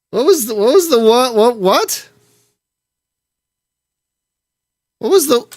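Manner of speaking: high, confused repeated whats; stumbling, high-pitched disbelief; baffled. Real, not acted. Player baffled by the mission target, high and confused.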